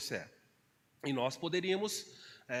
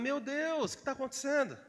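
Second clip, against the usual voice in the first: astonished; 8 semitones higher than usual. Retold or imitated. Astonished at empty pantry.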